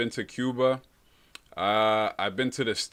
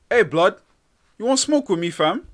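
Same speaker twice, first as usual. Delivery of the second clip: high, loud London roadman voice; mock UK accent; 8 semitones higher, 5 dB louder than usual. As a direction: ham up a British street accent. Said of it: "That's my U K accent".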